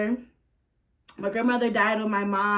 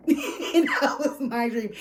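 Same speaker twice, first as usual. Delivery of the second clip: laughing; 3 semitones higher, slower than usual. Real, not acted. Laughs after emotional dream story.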